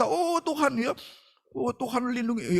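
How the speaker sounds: high-pitched pretend plea for protection; lightly hammed-up cry to God; mock urgent prayer. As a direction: act out a driver's panicked prayer. Acts driver praying with eyes shut.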